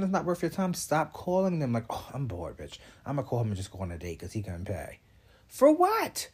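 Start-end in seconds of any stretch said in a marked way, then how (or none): 1.9-5.0 quiet mock-bored imitation; mock bored dater. 5.5-6.3 shrieked high for what; high, loud disbelieving cry; incredulous outburst. Real, not acted.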